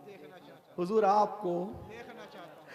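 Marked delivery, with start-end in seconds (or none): 0.1-0.7 hushed whisper trailing off; whispered longing. Acted. Timid youth whispers his longing at the door. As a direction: breathe the words as a timid whisper. Youth too shy to see Prophet.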